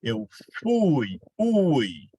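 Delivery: fui then ui said on its own, the u strong and the i gliding; diphthong demo. Shown as a demonstration. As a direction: point out the u in fui by repeating ui alone. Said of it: "Qual é o som mais forte?".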